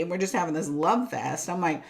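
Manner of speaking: ordinary delivery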